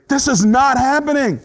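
loud, high, insistent refusal to believe; denial. Acted. Preacher acting out the first stage of grief, in adamant denial. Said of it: "Denial. This stage is when you say".

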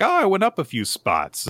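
a hint of a pleased little girl; lightly voiced pleased classmate; light perky Becky voice.

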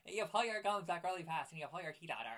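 loud, lips pulled back from the teeth; Fire Marshal Bill. Shown as a demonstration. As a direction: demonstrate a bared-teeth cartoonish character. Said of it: "Fire Marshal Bill".

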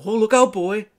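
high, loud call; acted warning. Companion warning Arthur at the sword, acted high alarm. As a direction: shout a mock warning to a boy.

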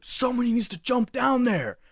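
high-pitched mock yell; retold yelling. Friends arguing over what to do, mock yelling. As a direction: call it out as a friend in a panic. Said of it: "start yelling at each other".

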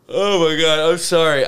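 talking through a yawn; words stretched by a yawn. Real, not acted. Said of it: "I'm yawning just 'cause I'm fucking beat".

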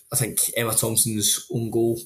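ordinary delivery

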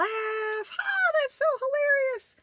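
very high mock amusement; dad laughing. Imitating dad after throwing the toy, tickled. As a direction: squeal it like someone tickled by their own prank. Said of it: "And he would laugh".

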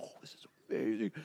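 hushed, quick whisper of wonder; awed whisper. Retold or imitated. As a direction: whisper it like a kid secretly thrilled. Boy secretly marvels on bike.

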